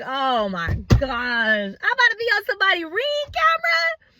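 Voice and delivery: panicked thought. Retold or imitated. Panicked inner thought on camera.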